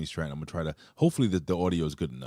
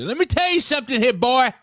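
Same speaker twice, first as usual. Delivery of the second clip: mock southern accent; 10 dB louder than usual. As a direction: imitate her southern heel taunt. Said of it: "turned into a southern accent".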